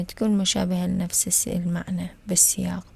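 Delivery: ordinary talk, nothing marked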